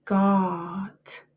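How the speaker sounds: carefully said spelling word; word said alone. Shown as a demonstration. Teacher saying the spelling word to the class, clear and careful. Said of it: "Your word is got".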